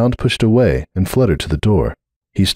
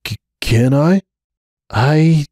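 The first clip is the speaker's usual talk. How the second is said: higher, stammering; nervous stammer; 7 semitones higher, slower than usual. Acted. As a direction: ask with shaky nerves. Nervous request.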